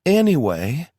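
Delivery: high, loud cut-in to change the subject; a loud, awkward Anyway; loud interruption. Acted. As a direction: break the awkward silence loudly. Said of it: "Tara said loudly".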